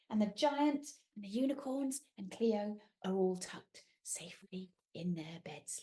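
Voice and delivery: ordinary delivery, nothing marked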